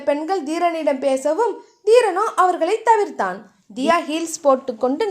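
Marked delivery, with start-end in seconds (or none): none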